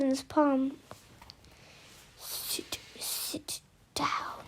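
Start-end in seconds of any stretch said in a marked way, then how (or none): 2.2-4.4 sharp whispered command; urgent hissed whisper. Acted. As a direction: hiss it through the teeth so no one hears. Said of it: "Conrad hissed".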